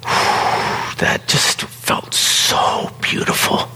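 a very slow sigh that carries the words. Real, not acted. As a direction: let the words ride out on a sigh. Sigh over a cherished feeling.

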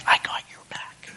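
hushed unvoiced reassurance; quiet whisper. [name] quietly reassuring Colleen, whispered. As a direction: breathe it discreetly so no one hears. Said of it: "quietly, discreetly".

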